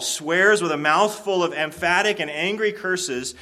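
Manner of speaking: preacher pushing to a loud peak; building preaching. Acted. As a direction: drive the story up to its climax. Preaches Peter's angry cursing.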